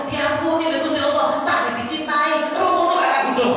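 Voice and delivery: high-pitched aggrieved lament; indignant devil. Acted. Devil protesting that the sinner is forgiven, high indignant wail. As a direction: complain high and stretched, in mock outrage.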